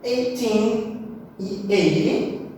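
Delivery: put-on slow "Eighteen e eighty": eigh-TEEN, then EIGH-ty; long "teen" in "Eighteen", stressed start in "eighty"; end-stressed "Eighteen" against front-stressed "eighty"; contrasting stress. Shown as a demonstration. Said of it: "sempre lembrando de diferenciar qual que é a stressed".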